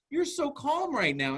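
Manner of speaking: put-on high, slow gushing to a child; amazed praise. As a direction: marvel at how well the kid is doing.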